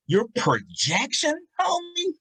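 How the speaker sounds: very high-pitched, drawn-out praise; amazed hype. Retold or imitated. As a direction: let the voice soar in astonishment. Teacher marvelling at boy's voice projection.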